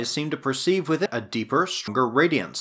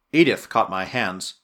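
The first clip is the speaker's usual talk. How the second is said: ordinary delivery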